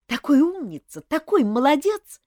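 rising, gushing admiration; enthusiastic praise. A narrator's reading. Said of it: "восторженно отозвалась та".

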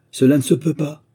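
high, loud indignant exclamation; outraged cry. A narrator's reading. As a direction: cry out in disbelief. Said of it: "s'écria Monsieur le Vent". (s'écrier, aigu et fort)

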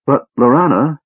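high, loud protesting call; crying out. A narrator's reading. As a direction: cry out to stop her. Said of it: "Tasselhoff cried".

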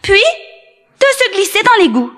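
high, sly little voice; Tom Pouce, tiny boy. A narrator's reading. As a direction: coax the wolf, high and small. Tom Pouce guides the wolf.

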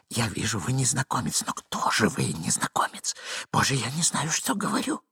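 soft, breathy apologetic murmur; hushed, rambling nervous voice; timid, flustered man. Acted. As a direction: plead in confusion, half whispering. Plays timid Ivan hiding.

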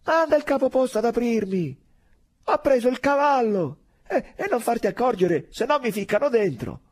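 loud, high, hurried calling; urgent shout. Acted. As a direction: shout the plea, keep it urgent. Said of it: "gridò".

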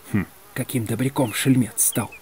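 hushed, softened aside; confidential whisper. A narrator's reading. Егор Егорыч to the doctor about the general, whispering. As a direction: mutter slyly into someone's ear. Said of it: "шепнул Егор Егорыч доктору на ухо".

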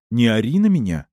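shouting match. A narrator's reading. Shouting match, she protests.

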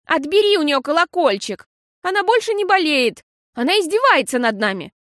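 loud, high angry complaint. A narrator's reading. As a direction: demand it furiously. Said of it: "сердито крикнула Пиппа".

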